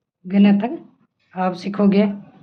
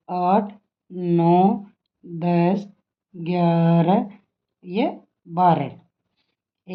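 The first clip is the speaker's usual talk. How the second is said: slow counting; 2 semitones lower, much slower than usual. Shown as a demonstration. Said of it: "teacher counting out slowly".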